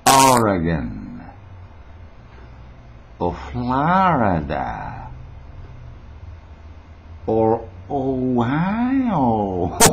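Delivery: very slow, each name stretched out; savoured place names. Pretend. Listing where internet viewers could be, drawn-out showy delivery. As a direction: draw out every name like a showman.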